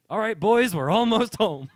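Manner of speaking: put-on high, loud rallying shout to the boys; hearty call-out. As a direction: holler it out like leading a troop home. Drunk husband calls out to snails.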